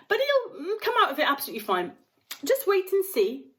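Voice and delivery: high, lilting voice with chuckles; Mister Wonka soothing the frantic mother; cheery reassurance. A narrator's reading. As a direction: soothe her with amused cheer. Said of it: "cried Mister Wonka".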